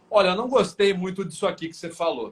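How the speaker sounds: reclamação ríspida; harsh complaint. Shown as a demonstration. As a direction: say it with an irritated edge. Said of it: "Ou eu posso falar".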